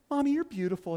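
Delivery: put-on a child gushing at mommy; child's voice. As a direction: say it like an adoring small child. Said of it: "When they say".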